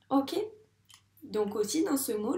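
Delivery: ordinary delivery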